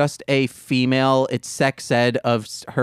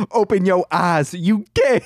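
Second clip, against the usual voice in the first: mock porn guy; 6 semitones higher than usual. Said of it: "he says".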